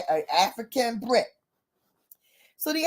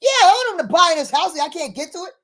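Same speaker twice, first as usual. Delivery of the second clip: louder, higher, rushed retort; indignant; 5 semitones higher, 5 dB louder, faster than usual. Retold or imitated. Buyer backs out of the deal, retold indignant retort. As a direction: imitate an exasperated comeback.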